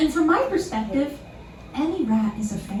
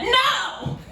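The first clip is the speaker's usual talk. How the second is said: high, drawn-out retold "no"; hammed-up high-pitched outrage; mock indignant refusal; 12 semitones higher than usual. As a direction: imitate an appalled store clerk refusing. Retold pet store lady's refusal.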